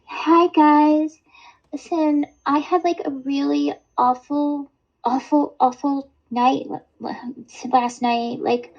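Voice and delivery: squeaky mock-upset girl; high-pitched imitation. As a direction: imitate her, high and breathless with woe. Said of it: "your higher pitched voice imitating people".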